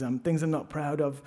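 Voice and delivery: normal talking voice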